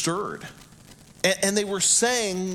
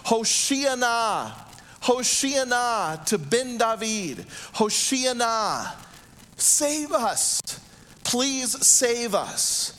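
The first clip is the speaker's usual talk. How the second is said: higher, drawn-out mock crowd cry; mild imitated shout, raised pitch; retold shouting; 6 semitones higher, much slower than usual. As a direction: call out as a pleading crowd, short of a full shout. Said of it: "they were all shouting in unison".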